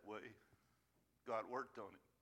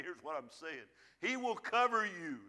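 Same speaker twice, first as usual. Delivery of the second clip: normal talking voice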